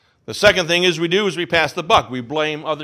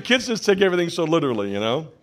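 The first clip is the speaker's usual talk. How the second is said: usual speaking voice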